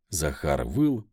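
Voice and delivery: usual speaking voice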